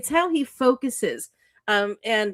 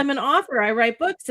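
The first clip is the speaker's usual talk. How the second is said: ordinary talk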